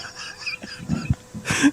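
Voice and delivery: high shrieking laugh; hysterical laugh. Real, not acted. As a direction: burst into helpless shrieking laughter. Laughing fit again.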